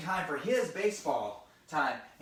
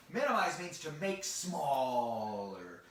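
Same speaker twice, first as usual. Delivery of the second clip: slow, drawn-out definition with a long "smaller"; slow explanation; 4 semitones lower, slower than usual. Shown as a demonstration. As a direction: stretch "smaller" right out. Explaining a big word to children.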